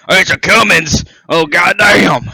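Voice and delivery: excited Southern guy. Retold or imitated. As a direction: hype it up like a truck guy. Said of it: "Southern boys getting one".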